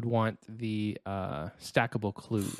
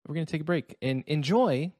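announcer voice; 3 semitones higher than usual. Pretend. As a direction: tease the ad like a host. Announcer voice introducing ad.

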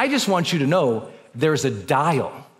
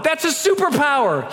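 excited awe; 10 semitones higher than usual. Real, not acted.

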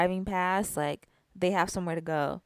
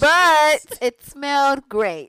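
delighted; 6 semitones higher, 9 dB louder, much slower than usual. Real, not acted. Emphatic delight about candles.

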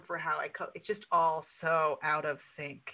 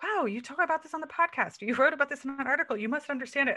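people gushing at her; 8 semitones higher, 4 dB louder, faster than usual. Retold or imitated. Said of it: "they'll be like".

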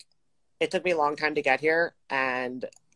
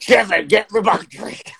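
demanding bro voice; 5 semitones higher, 7 dB louder than usual. Pretend. Mocking demanding gym bro.

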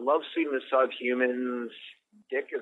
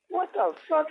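shocked ex-wife. Retold or imitated. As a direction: squeal it like the ex-wife hearing the news. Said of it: "She was like".

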